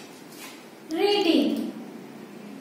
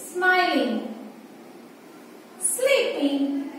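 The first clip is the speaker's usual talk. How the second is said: ordinary talk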